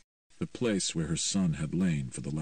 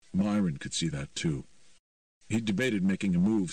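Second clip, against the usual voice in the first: usual speaking voice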